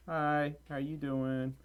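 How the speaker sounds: ordinary delivery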